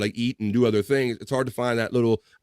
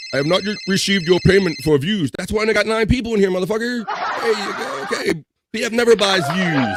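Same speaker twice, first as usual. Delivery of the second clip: high, louder mock complaint; mock demanding voice; 7 semitones higher, 3 dB louder than usual. Mock scolding Bob for unpaid views, higher and louder. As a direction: play a greedy, pushy hustler.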